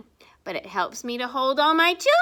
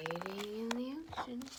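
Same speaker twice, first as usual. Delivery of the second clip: hushed secret whisper; 2 semitones lower, 15 dB quieter than usual. Acted. Whispering to children about hiding sea creatures. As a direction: lean in and whisper it. Whispered aside while reading.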